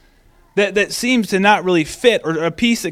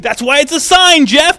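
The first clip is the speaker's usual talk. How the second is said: higher and louder, impatient; higher mock exasperation; exasperated shepherd; 7 semitones higher, 5 dB louder than usual. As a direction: tell Jeff off for missing the point. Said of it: "And we're like".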